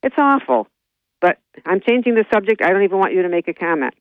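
ordinary delivery

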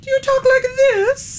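extremely high squeaky voice; squeaky silly voice. Shown as a demonstration. Host coaxing boy to do a voice, squeaky silly voice. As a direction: squeak it way up at the top. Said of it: "What's your voice?".

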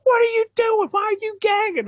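shrieking mother at the door; alarmed mom. Retold or imitated. Mother finding her son gagging over dog food, very high. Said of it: "she goes".

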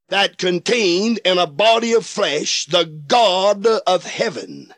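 ordinary talk

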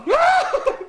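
real high burst of laughter. Real, not acted. Teller laughing at his own hunting story, high laugh. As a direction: break up laughing.

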